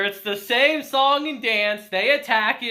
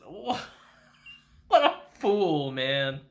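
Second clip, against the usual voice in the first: ordinary talk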